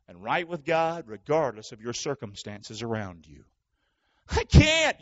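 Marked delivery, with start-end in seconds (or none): 4.3-5.0 whiny complainer. Pretend. Voicing a complaining believer.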